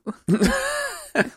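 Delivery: amused high cackle at a tease; high laugh. Real, not acted. Laughs at teasing of younger generation.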